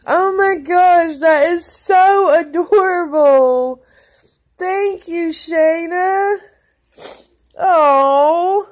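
high, loud, drawn-out tearful cooing; real happy crying. Real, not acted. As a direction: gush through tears at an adorable gift. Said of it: "Okay, I am crying".

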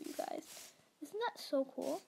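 ordinary talk

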